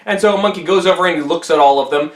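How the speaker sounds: plain reading voice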